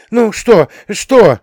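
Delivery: high, loud, impatient demand; urgent questioning. A narrator's reading. Family besieges the returning son, urgent questioning. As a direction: pounce on him for the news. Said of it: "обрушилась на Диму со всех сторон".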